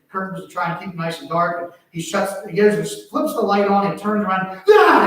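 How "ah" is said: sudden high loud scream of fright; startled scream. Retold or imitated. Boy caught by dad screams in fright. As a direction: yelp like a scared kid caught off guard. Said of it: "he screams real loud and scared".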